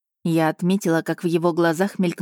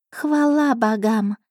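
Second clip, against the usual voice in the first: higher, slow relieved gasp; 6 semitones higher, slower than usual. Acted. The cat gasping with relief at her mistress, higher. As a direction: breathe it out in relief. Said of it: "ахнула она".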